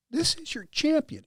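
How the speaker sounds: high-pitched, held-back mock scream. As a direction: proclaim it to an audience in a strained high voice. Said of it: "he screams into the audience".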